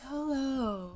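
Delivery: a hint of a polished announcer greeting; mock announcement voice. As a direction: tease mom by imitating her announcer voice. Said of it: "And then she's like".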